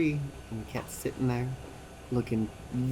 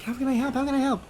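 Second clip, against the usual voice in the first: much higher, louder, frantic offer; flustered wife; 13 semitones higher, 5 dB louder, faster than usual. Retold or imitated. Wife offering help while the cat claws him, frantic and high. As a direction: squeal it like a flustered helper. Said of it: "And she's like".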